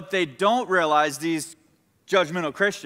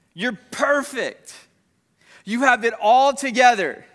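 pointed challenge; 5 semitones higher, 3 dB louder than usual. Acted. Jesus challenging the sinless accusers, high, pointed emphatic challenge. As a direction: build louder through each pointed compliment.

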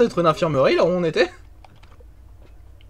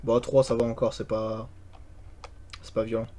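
whispering while hiding; 10 semitones lower, 13 dB quieter than usual. Real, not acted. Ghost hunt, keeping voice down.

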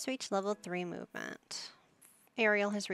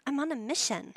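mock sim voice; 5 semitones higher, 4 dB louder than usual. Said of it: "She's like".

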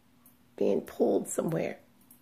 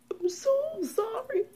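much higher whispered sob, slow; weeping apology; 12 semitones higher, slower than usual. Retold or imitated. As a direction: let the apology dissolve into tears. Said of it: "I began to weep".

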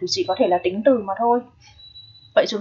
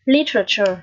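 Literature, stress the first syllable LI clearly. Shown as a demonstration. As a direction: model the word Literature with the first beat strong. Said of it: "Trọng âm ở đầu nhá". (đọc mẫu Literature, nhấn âm đầu)

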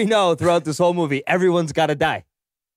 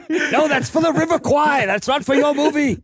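higher and laughing, a playful scolding; mock retort; 10 semitones higher than usual. Teasing a friend's bit, a high laughing retort. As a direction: laugh while putting him right.

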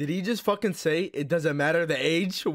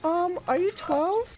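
high squeaky voice through a voice changer; voice changer girl voice; 13 semitones higher, much slower than usual. Acted. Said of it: "So I put on a voice changer".

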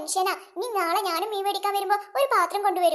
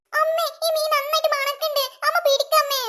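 put-on higher, small child voice; 6 semitones higher than usual. Little girl complaining to her mother about the fish basket, higher child voice. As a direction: play a whiny little girl.

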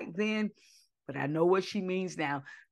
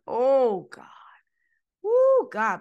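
loud exclamation, much higher on Woo; ecstatic outcry; 6 semitones higher, 7 dB louder, slower than usual. Real, not acted. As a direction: overcome with worship, cry it out. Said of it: "I feel your presence even now".

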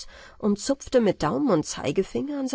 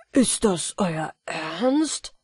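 slow, hushed question in disbelief; whispered disbelief; awed whisper; much slower than usual. A narrator's reading. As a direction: breathe it out in wonder. Jack asks in awe.

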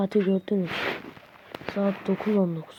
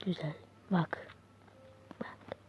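usual speaking voice

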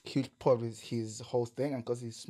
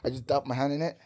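ordinary talk

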